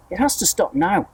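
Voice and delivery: high urgent yell. A narrator's reading. Aunt yells at the growing peach, high and urgent. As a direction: yell it out, worked up. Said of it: "Aunt Spiker yelled".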